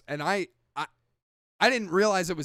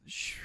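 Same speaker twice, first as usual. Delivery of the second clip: soft voiceless whoosh; 11 dB quieter than usual. Retold or imitated. Showing the actor slipping past, soft whoosh. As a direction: breathe out a quick rush of air. Imitates a ghost passing by.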